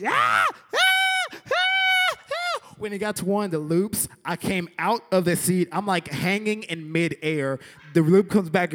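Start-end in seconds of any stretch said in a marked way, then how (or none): 0.1-2.7 piercing high mock shrieks; imitated little-girl scream. Rider as coaster takes off, high girlish screaming. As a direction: let out repeated loud, girlish screams. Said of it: "screamed like a little girl so loud".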